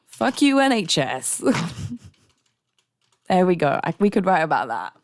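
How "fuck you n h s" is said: cathartic curse. Real, not acted. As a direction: vent at the system. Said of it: "Ranting is therapy".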